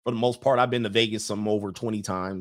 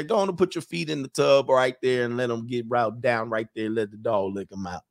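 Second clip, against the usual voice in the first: Southern charm. Pretend. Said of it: "turn on that little Southern charm".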